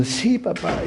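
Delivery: put-on high, soft little-boy yes; small scared answer; timid child. Boy confirms the dog to his father, timid child.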